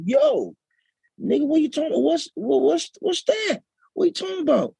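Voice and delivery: high-pitched, incredulous mock American voice; startled American friends. American friends catch him speaking Naija, mock high-pitched disbelief. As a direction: pitch it way up, imitating a baffled friend.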